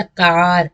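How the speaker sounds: कार with a big long aa; long vowel. Shown as a demonstration. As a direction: stretch the aa in कार. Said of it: "यहाँ पे देखा बड़ा निकला".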